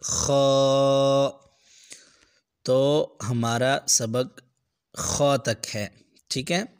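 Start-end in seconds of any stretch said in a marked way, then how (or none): 0.0-1.3 खौ with a scraping back-of-throat kh; raspy kho. Shown as a demonstration. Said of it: "ये वाला ख".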